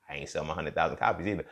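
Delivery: ordinary talk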